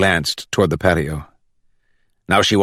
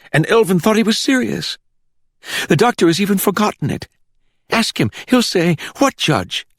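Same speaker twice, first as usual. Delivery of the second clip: much higher, quick eager telling; eager explanation; 13 semitones higher than usual. A narrator's reading. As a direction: grab the excuse and run with it. Hector eagerly explains away the plot.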